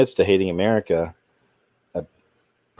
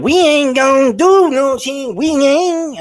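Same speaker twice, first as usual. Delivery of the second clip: hammed-up Southern accent, much higher and slow; exaggerated Southern accent, pitched way up, drawn out; mock Southern accent; 6 dB louder, slower than usual. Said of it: "her using her accent in front of a Southern crowd".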